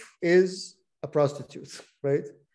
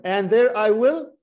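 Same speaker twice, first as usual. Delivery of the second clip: "I will" slow and louder; stress on I will; 5 semitones higher, 7 dB louder, slower than usual. Shown as a demonstration. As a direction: hit "I will" so they can count it. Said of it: "How many "I will" can you count".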